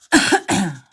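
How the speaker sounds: single hard cough; sharp real cough; coughing fit. Real, not acted. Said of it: "and coughing".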